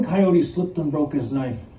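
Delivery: ordinary delivery, nothing marked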